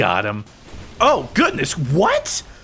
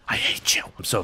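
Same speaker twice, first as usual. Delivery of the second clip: whispered, sulky; whispered grumble; 9 semitones lower, 5 dB quieter than usual. Real, not acted. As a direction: hiss it under your breath. Muttered insult at enemy.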